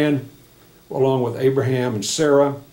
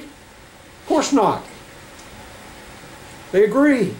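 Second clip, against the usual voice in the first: raised, ringing pulpit voice; fervent preaching; 6 semitones higher than usual. Acted. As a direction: preach it to the congregation. Preaching scripture to congregation.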